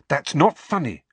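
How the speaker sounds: quick sharp rebuke. Acted. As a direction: snap it back at him. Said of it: "said Hermione sharply".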